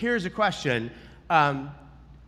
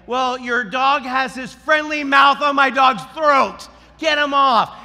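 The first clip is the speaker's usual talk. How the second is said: loud, high, indignant reenactment; angry retort; 7 semitones higher, 7 dB louder than usual. Retold or imitated. Walker confronting the dog's owner, angrily loud. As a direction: bellow it at the careless owner.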